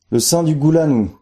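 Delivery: high, solemn well voice; the hidden saint in the well, high and solemn. A narrator's reading. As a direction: announce yourself from below. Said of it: "répondit la voix".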